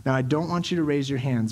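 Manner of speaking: ordinary talk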